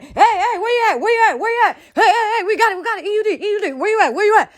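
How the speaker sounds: high, hammed-up yells up the stairs; mock search shouting, half laughing; mock yelling. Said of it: "start yelling".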